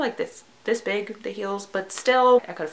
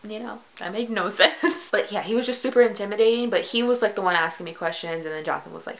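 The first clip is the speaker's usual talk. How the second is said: normal talking voice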